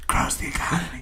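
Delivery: breathy joking whisper. Acted. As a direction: whisper it close to the mic. Whispered joke after laugh.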